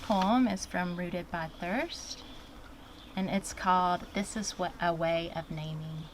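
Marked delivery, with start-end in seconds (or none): none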